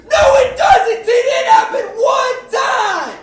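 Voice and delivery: loud, high, slowed protest; loud exasperated rebuttal; indignant shout. Acted. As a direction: shout it back at her. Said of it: "comic retort to Tina".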